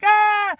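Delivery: sudden high loud scream of pain. Retold or imitated. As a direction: shriek as the ants bite. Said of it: "And I'm screaming".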